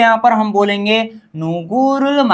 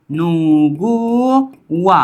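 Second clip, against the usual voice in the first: slow drawn-out model word; 3 semitones lower, much slower than usual. Shown as a demonstration. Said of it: "누구와 का मतलब होता है".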